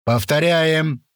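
slow, high barked order; drill instructor. A narrator's reading. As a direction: order them to repeat. Sergeant instructing.

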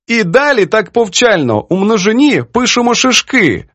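high, lively storytelling in character; animated old poetess. Acted. Poetess reads editor's letter to her friend, mockingly. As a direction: relish the editor's pedantry in a high voice.

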